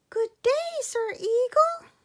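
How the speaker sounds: put-on high, loud eager little boy voice; a tiny boy pleading to help, pitched high and loud; little boy Sandangao. Child hero voiced in story.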